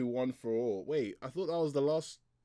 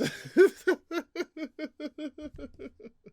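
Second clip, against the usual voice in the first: long, high-pitched real giggle; helpless high laughter that runs on; laughing fit; 4 dB louder than usual. Real, not acted. Laughs at crybaby heroes line.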